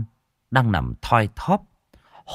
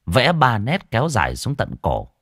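usual speaking voice

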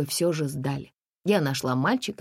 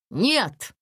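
higher, sharp refusal; snapped no; 7 semitones higher than usual. Acted. Mother refusing the man sharply. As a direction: bark the no. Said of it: "рявкнула мама".

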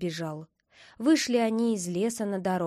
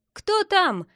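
high, loud call from above; squirrel calling down; 9 semitones higher, 6 dB louder than usual. A narrator's reading. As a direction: call out from up in the tree. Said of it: "крикнула сверху белка". (белка громко кричит сверху)

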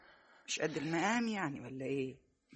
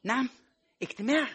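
higher, louder "a meeting?"; an offended, pitched-up question; indignant surprise; 6 semitones higher, 5 dB louder than usual. Acted. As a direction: throw the word back in outrage. Mother outraged at excuse.

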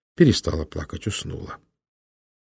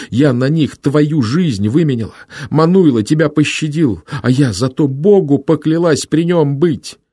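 angry outburst; 9 semitones higher, 7 dB louder than usual. Acted. Sister shouts back in quarrel.

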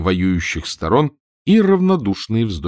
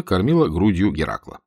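ordinary delivery, nothing marked

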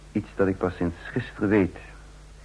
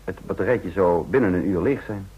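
ordinary talk

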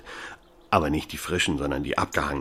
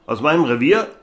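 higher, incredulous radio check; surprised; 9 semitones higher, 6 dB louder than usual. Acted. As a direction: disbelief on the radio. Policeman gets radio message. (ungläubig überrascht)

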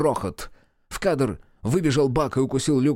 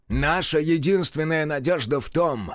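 высокий напористый крик; кричит в лицо, выше обычного; вопль; 7 semitones higher than usual. A narrator's reading. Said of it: "завопил Мерл ему в лицо". (Мерл кричит Люку в лицо)

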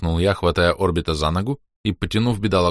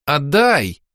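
put-on demanding girl; 7 semitones higher, 4 dB louder than usual. A narrator's reading. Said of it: "повторила юная богиня".

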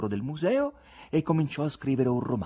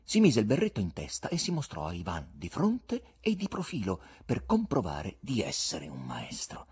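plain reading voice

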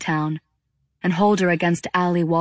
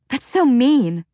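high, loud, hurt protest; a teenager yelling at her mother; hurt outburst; 7 semitones higher, 4 dB louder, slower than usual. Acted. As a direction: throw it back at her mother. Daughter shouts at her mother.

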